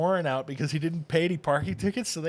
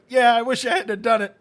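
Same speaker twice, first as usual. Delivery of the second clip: laughing cop quote; 8 semitones higher, 12 dB louder than usual. Retold or imitated. Laughing while quoting officer.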